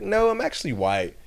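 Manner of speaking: a high, prim put-on voice.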